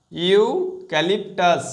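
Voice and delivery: Eucalyptus said very slowly, every syllable stretched; slow, drawn out. Shown as a demonstration. Teacher to students drilling the spelling, very slow eucalyptus. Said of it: "E ইউ".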